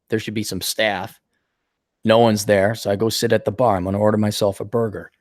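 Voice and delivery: ordinary talk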